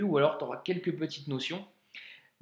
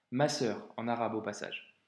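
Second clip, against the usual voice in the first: ordinary talk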